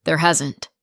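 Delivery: firm, curt contradiction; level, decisive interruption; calm brutality. A narrator's reading. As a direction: cut them off calmly, leaving no room. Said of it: "cut him off with calm brutality".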